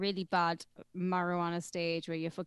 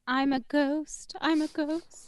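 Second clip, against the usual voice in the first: high, drawn-out mock ghost voice; 7 semitones higher, slower than usual. Co-host mimicking someone who says they're a ghost, high and slow. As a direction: play a spooky ghost, pitched up and slow.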